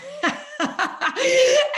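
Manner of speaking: bursts into a high, loud laugh. Real, not acted. Laughing after joking with the interviewer, high loud laugh. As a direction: let a big giggle spill out. Laughing at her own joke.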